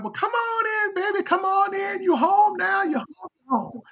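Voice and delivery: high falsetto, sing-out welcome; mock crooning welcome to a returning child; imitating welcoming old folks. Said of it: "they were like".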